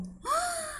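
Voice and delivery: sharp high excited gasp; gasp of wonder. Acted. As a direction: gasp to amaze the kids. Storyteller builds suspense. (suspiro de espanto)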